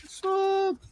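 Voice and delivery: very high, stretched-out sucks; mock squeal. Mocking people who dismiss the cartoon, squeaky sneer. Mocking critics again, higher.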